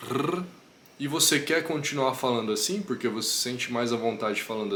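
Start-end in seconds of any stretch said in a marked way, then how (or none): 0.1-0.4 r trilled with the tongue tip; rolled tongue-tip R. Shown as a demonstration. Teacher demos the tongue-tip R. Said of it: "esse da ponta da língua".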